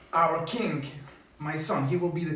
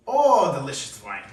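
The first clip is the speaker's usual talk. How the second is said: king savoring wine; 3 semitones lower, 6 dB louder than usual. Acted. King tasting wine in story.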